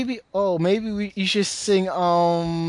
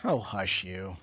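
playful hush; 11 semitones lower, 7 dB quieter, slower than usual. Acted. Playful reply to teasing.

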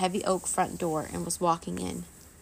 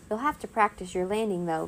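ordinary delivery, nothing marked

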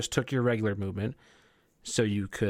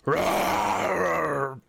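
big goblin roar; 8 semitones higher, 4 dB louder than usual. Acted. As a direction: belt out a monstrous roar. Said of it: "fucking belts out".

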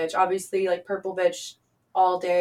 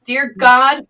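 higher, louder begging; loud pleading; 5 semitones higher, 10 dB louder than usual. Real, not acted. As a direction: beg them to subscribe. Loud plug for her videos.

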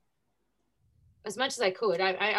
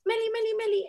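put-on drunk guy calling; 11 semitones higher than usual. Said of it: "And he goes".

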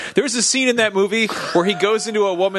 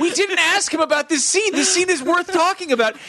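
mock interviewer; 11 semitones higher than usual. Acted quote, pitched up.

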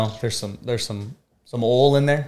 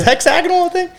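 incredulous. Real, not acted. Baffled, high squeak.

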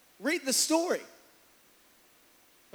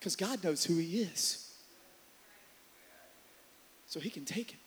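ordinary talk

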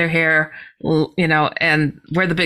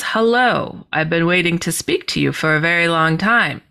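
ordinary delivery

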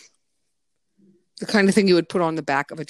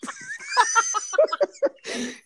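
high-pitched real laughing fit; helpless laughter. Real, not acted. Masseuse remembering the horrified client, helpless laughter. Cracks up at own story.

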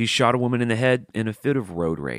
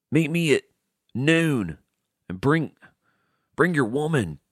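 raised pitch, words doled out with pauses; mock duel challenge; 6 semitones higher, slower than usual. Mock fighter summoning a rival to a noon duel, slow and portentous. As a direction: issue a hammed-up challenge, halting for effect.

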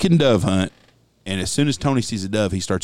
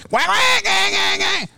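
duck call honks; slower than usual. Retold or imitated. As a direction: shout out overblown duck calls. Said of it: "it's got".

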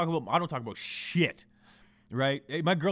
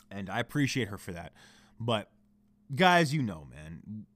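ordinary talk

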